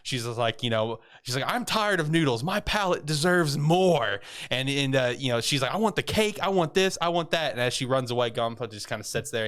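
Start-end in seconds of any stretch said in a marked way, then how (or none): 1.5-4.2 higher-pitched, hammed-up complaint; mock demanding girl. Retold girl demanding better food. 5.7-7.5 high-pitched, insistent list of wants; mock childish demands. Retold girl rattles off demands.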